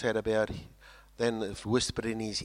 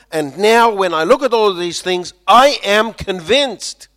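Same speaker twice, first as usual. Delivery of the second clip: rising to a loud, high declaration; building sermon climax; 7 semitones higher, 14 dB louder than usual. Acted. Preacher proclaiming God's work, rising to certainty.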